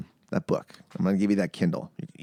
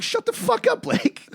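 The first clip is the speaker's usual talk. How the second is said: mock snap; slower than usual. Mocked by friend, snapping back.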